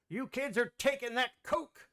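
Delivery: put-on high, lightly cranky old man. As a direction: scold the kids like an old-timer. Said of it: "You sound like a ninety-year-old person".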